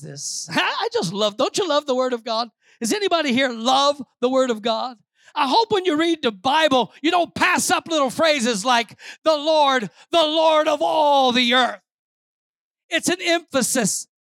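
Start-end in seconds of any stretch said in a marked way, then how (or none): 5.3-13.1 raised, punchy pulpit voice; high, emphatic preacher's delivery; forceful preaching. Real, not acted. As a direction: press the point on the congregation.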